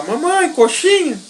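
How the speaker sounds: put-on high, sing-song "coxinha" for the child to copy; high prompt for a toddler. Shown as a demonstration. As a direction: say "Mamãe, coxinha" up high like a little kid. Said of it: "chama sua mãe e fala".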